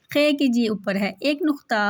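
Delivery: ordinary talk, nothing marked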